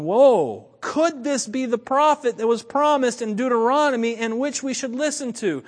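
amazed crowd. Retold or imitated. Some in the crowd reacting to a possible prophet, amazed. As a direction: act out a crowd's dawning astonishment.

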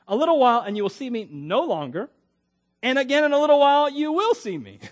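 loud, high, exaggerated scripture reading; hammed-up proclamation of a puzzling verse; emphatic rereading. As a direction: declaim the riddle, hitting each phrase hard.

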